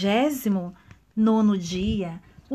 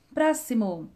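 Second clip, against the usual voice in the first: plain reading voice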